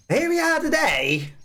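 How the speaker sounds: high-pitched American accent drifting into Australian; attempted American accent. Shown as a demonstration. Trying the requested American accent, it comes out Australian-sounding. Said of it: "that sounds too Australian".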